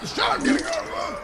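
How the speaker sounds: high, hurried shouted orders; high panicked commands to flee; urgent barked order. Acted. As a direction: hustle your partner into the car now.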